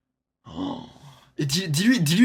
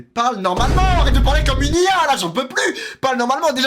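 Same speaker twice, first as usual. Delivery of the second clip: exasperated yell; 10 semitones higher, 7 dB louder than usual. Real, not acted. Host yells at kid.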